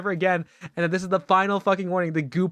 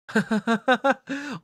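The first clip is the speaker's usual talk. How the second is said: laughing; 7 semitones higher than usual. Real, not acted. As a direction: burst of real laughter. Laughing at reading.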